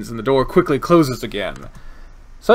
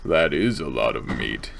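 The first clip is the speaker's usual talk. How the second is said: very low, show-off voice; deep microphone voice; 10 semitones lower, 4 dB quieter than usual. Shown as a demonstration. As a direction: drop right down into a deep voice. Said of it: "do good things for my voice".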